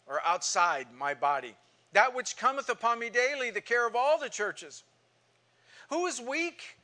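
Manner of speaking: fervent Bible reading, building in force; scripture reading. Acted. Pastor reading Paul's letter to the class, fervent. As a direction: read Paul's hardships like a preacher pressing each line.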